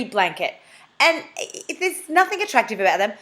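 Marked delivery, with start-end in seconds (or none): none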